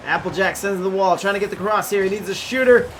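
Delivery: fast, rising sports commentary; live play-by-play. Acted. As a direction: call the game as it happens.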